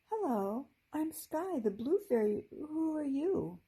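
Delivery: a hint of just-woken drowsiness; sleepy voice. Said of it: "she said in a sleepy voice".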